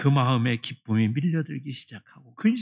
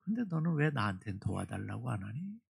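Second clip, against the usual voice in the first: soft voice of the Lord; 5 semitones lower, 9 dB quieter than usual. Retold or imitated. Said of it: "주님이 그에게 이렇게 대답하시더래요".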